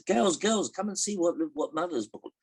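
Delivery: high-pitched call to her daughters; mother calling. Acted. Woman calls down her daughters, high calling voice. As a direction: put on a mother's high summoning voice. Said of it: "she calls down her daughters".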